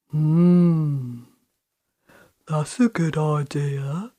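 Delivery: low, musing troll voice; thoughtful troll. A narrator's reading. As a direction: weigh the idea like a slow troll. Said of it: "agreed the Troll".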